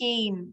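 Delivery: "came" with the a held long; long vowel. Shown as a demonstration. Teacher giving a long-vowel example, "came" drawn out. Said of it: "long हो जाता है".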